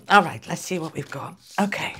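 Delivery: usual speaking voice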